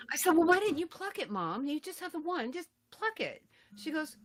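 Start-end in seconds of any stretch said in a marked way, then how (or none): 0.3-3.4 high-pitched nagging at mom; retold daughterly exasperation; exasperated pleading. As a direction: reason with her, pitched up in disbelief.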